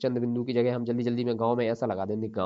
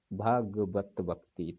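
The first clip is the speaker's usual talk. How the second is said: भगवत भक्ति said very slowly; much slower than usual. Shown as a demonstration. Teacher modelling to students, very slowly. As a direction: lay the words out one by one. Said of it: "तो भगवद हो जाता है".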